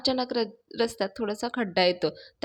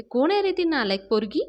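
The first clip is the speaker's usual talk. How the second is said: a higher, louder imitated outburst; mock angry retort; 5 semitones higher, 4 dB louder than usual. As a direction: retell her flare-up with a raised voice. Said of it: "मी चिडते आणि म्हणते".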